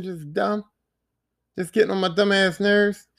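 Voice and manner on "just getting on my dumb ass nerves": usual speaking voice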